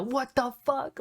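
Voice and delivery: half-serious outburst, not full volume; mock yell. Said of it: "M J F yelling throughout the match".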